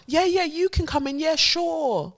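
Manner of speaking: high, sweet mock welcome; mock welcoming. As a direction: gush the easy welcome sarcastically. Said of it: "how the modern world holds Israel".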